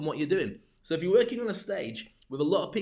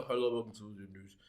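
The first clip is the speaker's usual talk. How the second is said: lower, softer flat news anchor voice; stiff newsreader; 7 semitones lower, 5 dB quieter than usual. Shown as a demonstration. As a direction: read it like a dull newsreader. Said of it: "but I was to go".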